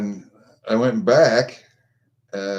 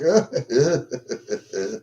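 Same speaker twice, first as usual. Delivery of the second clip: long breathy run of chuckles; amused, drawn-out laugh; real chuckle; 2 semitones higher than usual. Real, not acted. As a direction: laugh it off in quick pulses. Chuckles at accent remark.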